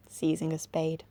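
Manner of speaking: ordinary delivery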